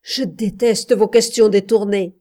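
shouted protest, fed up; crying out. A narrator's reading. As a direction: throw the words back at him. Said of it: "cria-t-elle".

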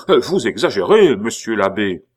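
high, loud outburst, then settling; beside himself. A narrator's reading. Pitou rejecting the accusation, beside himself. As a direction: burst out in exasperated protest. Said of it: "jeté hors de lui-même".

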